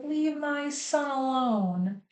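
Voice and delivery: protective pleading. Retold or imitated. Grandparents defending their son to the very end, imitated pleading. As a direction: draw it out as a mock protective parent.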